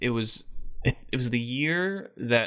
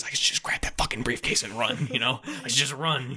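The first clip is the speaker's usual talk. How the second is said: unvoiced whisper of a secret plan; whispered thoughts. Patton secretly thinking of stealing the briefcase, whispered. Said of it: "all he's thinking is".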